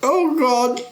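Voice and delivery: high panicked oh God; terrified captive. Acted. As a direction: cry out as the gag comes. Captive NPC being gagged.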